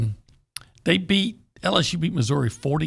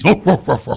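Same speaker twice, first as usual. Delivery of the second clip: dog barking; 7 dB louder than usual. Pretend. Barking for the Huskies.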